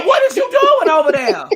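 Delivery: retold outburst at the noisy neighbor; mock angry yell. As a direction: shriek it through laughter like a furious neighbor. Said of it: "I said".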